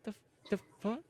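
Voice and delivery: tiny, high, faltering voice; stunned bewilderment. Retold or imitated. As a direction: barely get it out, very soft and stammering. Left standing stunned after attack.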